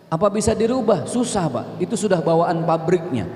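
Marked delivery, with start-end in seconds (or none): none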